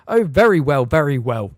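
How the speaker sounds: loud, irritated snap; snapped. A narrator's reading. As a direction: bite off the words impatiently. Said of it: "snapped Fudge".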